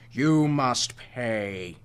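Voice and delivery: slow, eerie repeat of the threat; haunting, drawn-out echo of the curse; sobbing monster echo. A narrator's reading. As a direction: let the words hang, mournful and menacing. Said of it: "a voice sobbed from above".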